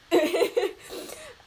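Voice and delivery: high giggling laugh; laughing at her own makeup struggle; burst of laughter. Real, not acted. Vlogger laughing at her makeup fail, high giggle.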